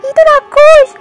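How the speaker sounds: high, loud panicked shrieks at the demon; high frightened yelling; terrified scream. Acted. Sees demon, screams in terror.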